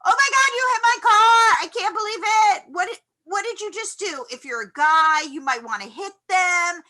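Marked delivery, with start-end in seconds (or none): 0.1-4.2 high, loud, panicked rant at the other driver; you can't believe they hit your car; frantic disbelief. Retold or imitated. Driver yelling at the one who hit the car, frantic. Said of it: "You're like".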